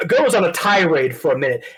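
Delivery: ordinary talk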